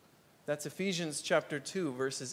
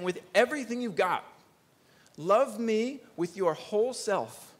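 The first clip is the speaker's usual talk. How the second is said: fervent plea; 7 semitones higher, 5 dB louder than usual. Acted. Voicing God's command, fervent.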